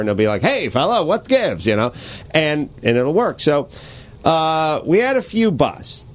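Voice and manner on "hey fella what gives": a hint of twenties radio announcer; lightly voiced vintage radio host; old-timey radio guy. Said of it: "old-timey twenties radio guy".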